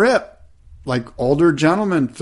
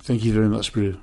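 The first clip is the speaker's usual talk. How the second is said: put-on quieter South African accent; 2 semitones lower, 5 dB quieter than usual. Thanking co-host for the compliment, mock South African accent. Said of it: "a solid South African accent, too".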